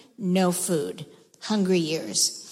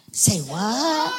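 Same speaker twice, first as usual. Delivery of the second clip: say what. Shown as a demonstration. Leading children in a shocked say what. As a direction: play it for the kids as shock. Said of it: "Show me say what".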